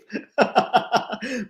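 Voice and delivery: quick, high burst of laughter; amused laugh. Real, not acted. Streamer reacting to a viewer's sunshine joke, amused high laugh.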